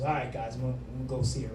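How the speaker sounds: a hushed, resigned reply; quiet mumble. Retold or imitated. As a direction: mutter it low, caught out. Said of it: "And he goes".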